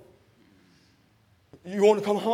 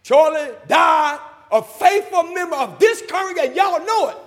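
full-force preaching; 10 semitones higher, 10 dB louder than usual. Real, not acted. Preacher testifying that his late sister was faithful, full-force preaching. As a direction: proclaim it loudly to the congregation.

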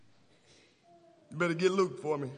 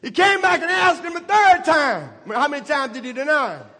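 higher, shouting climax, slowing on the last words; full-force preaching; 9 semitones higher, 11 dB louder than usual. Real, not acted. Preacher climaxes on Peter's answer.

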